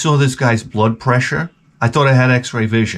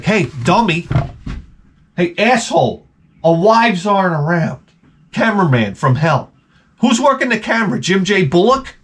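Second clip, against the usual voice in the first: higher-pitched mock scolding; indignant heckling; 7 semitones higher than usual. Viewer berates the TV cameraman, exasperated and high.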